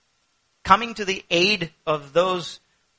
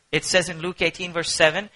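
ordinary delivery

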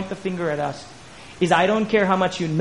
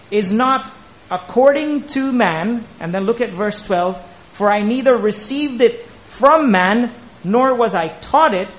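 slow, raised, forceful verse reading; emphatic scripture preaching; 5 semitones higher, 5 dB louder, slower than usual. Real, not acted. Preacher quoting Paul's verse to the congregation, emphatic. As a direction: hammer each phrase of the verse, slowly.

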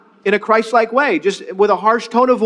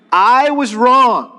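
slow and higher, louder; slow, louder model line; 4 semitones higher, 4 dB louder, much slower than usual. Shown as a demonstration. Pastor cheering congregation on, slow and louder. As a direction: lift them along with it. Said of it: "You can do it".

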